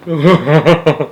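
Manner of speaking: high, gleeful cackle; manic laugh. A narrator's reading. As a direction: laugh with giddy madness. Wonka laughs.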